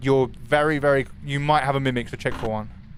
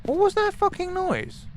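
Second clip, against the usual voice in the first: startled squeak. Real, not acted. Startled by a noise.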